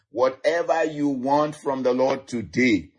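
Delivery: ordinary talk, nothing marked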